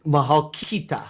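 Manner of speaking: "Mahal kita" with KI picked out clearly; teacher's careful repeat of "Mahal kita"; Tagalog correction. Shown as a demonstration. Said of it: "in Tagalog".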